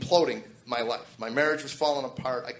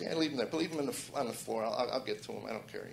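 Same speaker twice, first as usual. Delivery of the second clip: soft, lower, dismissive inner voice; little quiet voice; 3 semitones lower, 7 dB quieter than usual. Retold or imitated. Inner voice urging him to ignore tapes: soft dismissive murmur. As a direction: lull him into leaving them. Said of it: "the little quiet voice says".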